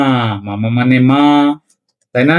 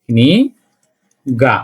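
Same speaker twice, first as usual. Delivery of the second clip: drawn-out teaching demo; 3 semitones lower, much slower than usual. Shown as a demonstration. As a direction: stretch every sound of কনিগা very slowly. Teacher drawing out a new word.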